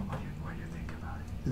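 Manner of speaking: barely audible whisper; tiny, unprojected voice; too quiet to hear. Shown as a demonstration. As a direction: whisper the English question timidly. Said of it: "全く後ろの人聞こえないと思いますよ".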